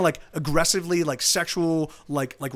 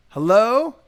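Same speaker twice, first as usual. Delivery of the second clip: louder, questioning hello; calling out; 3 semitones higher, 7 dB louder than usual. Retold or imitated. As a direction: call out to see if anyone answers. Calls out into empty house.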